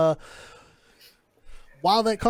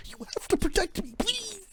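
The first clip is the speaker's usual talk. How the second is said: high, soft, frightened begging; paranoid plea; 13 semitones higher, 7 dB quieter than usual. Acted. Paranoid informant begging the City Watch for protection. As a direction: plead much higher and softer, scared. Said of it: "Looking super paranoid".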